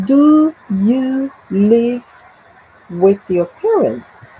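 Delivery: slow, separated words in "Do you live with your parents"; word-by-word model. Shown as a demonstration. Teacher reading out an example question, slow and word by word.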